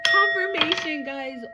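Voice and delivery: high, shouted Confirmation; real joyful shout; excited shout. Real, not acted. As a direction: call it out triumphantly. Excited about the sign.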